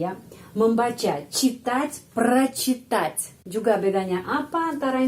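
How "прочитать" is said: прочитать slow, o said as a; teacher modelling прочитать; slow careful Russian. Shown as a demonstration. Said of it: "penekanannya di sini".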